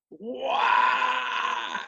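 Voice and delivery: a high, crying wail like a jungle animal; imitated hyrax scream. Mimicking the tree hyrax for the interviewer, high wailing cry. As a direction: belt out the animal's night scream in one burst. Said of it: "a resounding scream".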